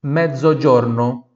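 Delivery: Mezzogiorno with voiced dz, medzo-djorno, loud; Italian ZZ model. Shown as a demonstration. Teacher models Mezzogiorno for students, loud and clear.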